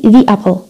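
"the apple" with THE said "thee", unemphasized; unstressed THE. Shown as a demonstration. Teacher demonstrating the apple for students, weak "thee". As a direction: glide "thee" into "apple" without stressing it. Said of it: "ما بدي أأكد على الأبل".

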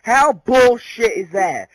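loud angry swearing, slowing at the end; yelling after flubbing a lyric; frustrated outburst. Real, not acted. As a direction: blow up at your own mistake. Messed up the lyric.

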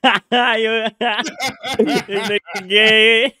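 high, loud words through laughter; laughing hard. Real, not acted. Teasing a stranger over franks, laughing hard. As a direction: crack up while talking, dragging out the last word.

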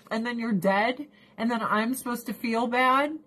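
ordinary talk

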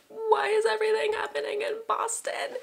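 very high, thin squeal of disbelief; exasperated fan wail. Real, not acted. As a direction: whine it way up high. Exasperated excitement about home city.

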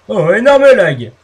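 loud annoyed exclamation; annoyed outburst. Real, not acted. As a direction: blurt it out, irritated. Game freezes suddenly.

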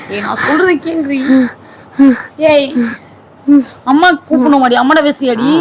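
crying as she begs; real tears in the voice; tearful plea. Real, not acted. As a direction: sob through the plea. Crying while pleading with friend.

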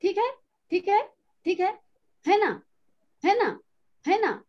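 ऊँचे सुर में बार-बार "ठीक है", "है ना" की नकल; तकिया कलाम वाले वक्ता की चिढ़ाती नकल; mimicking a verbal tic. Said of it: "कोई कहता है". (किसी की आदत "ठीक है, है ना" को ऊँचे स्वर में दोहराना)

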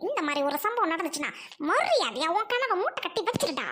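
normal talking voice

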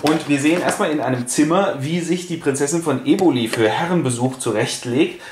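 ordinary talk

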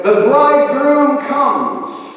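high, loud, drawn-out announcement; herald's call ringing through the night; crying out. Acted. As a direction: proclaim it slowly to wake sleepers. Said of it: "a voice cried out".

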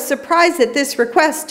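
usual speaking voice

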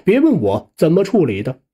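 plain reading voice

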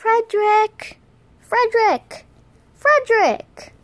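high, loud repeated name call; insistent make-believe shouting; pretend calling out. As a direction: holler for someone out of earshot. Calling someone who isn't answering.

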